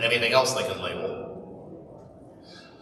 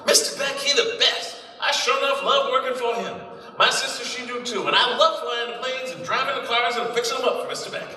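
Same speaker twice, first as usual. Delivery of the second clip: eager mechanic; 11 semitones higher than usual. Acted. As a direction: gush like a simple loyal grease monkey. Mechanic voiced in newsreel.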